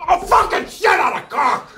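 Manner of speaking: high, very loud cursing outburst; Tony Robbins. Acted. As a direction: explode at a botched take, hammed up. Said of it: "He's, like, filthy".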